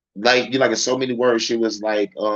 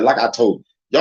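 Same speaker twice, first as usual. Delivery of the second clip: usual speaking voice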